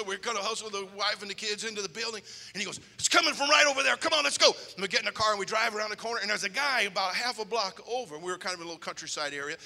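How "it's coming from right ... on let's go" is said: high, loud rallying call; urgent call. Retold or imitated. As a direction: push him to move now. Urging the pastor to chase.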